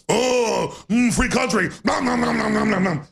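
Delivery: hammed-up munching monster voice; Cookie Monster. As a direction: gobble it up like a cartoon monster. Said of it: "when I do Cookie Monster".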